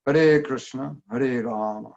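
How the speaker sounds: careful Sanskrit "Hare Krishna", "Hare Rama"; "Hare Krishna", "Hare Rama" with every sound precise; Sanskrit pronunciation. Shown as a demonstration. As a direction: give "Krishna" its full r and sh. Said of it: "That's the Sanskrit".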